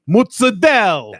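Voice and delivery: drawn-out Italian-American "mootsadell"; Italian-American "Mozzarella" said "mootsadell"; Italian-American accent. Shown as a demonstration. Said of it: "I say it like that".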